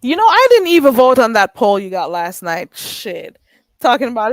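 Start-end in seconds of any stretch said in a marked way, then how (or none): none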